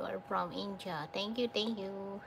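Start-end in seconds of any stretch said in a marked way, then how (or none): none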